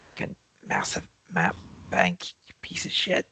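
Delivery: whispered frustrated swearing at the computer; muttered curse. Real, not acted. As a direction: hiss it under your breath, annoyed. DM cursing at slow map.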